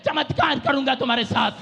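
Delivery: God vowing a miracle, rising. Acted. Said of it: "कहता".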